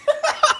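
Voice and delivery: high, short stifled giggle; giggling. Retold or imitated. Retelling the drive-thru guy trying not to laugh, giggling. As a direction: giggle while trying to hold it in. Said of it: "He's over there giggling to himself".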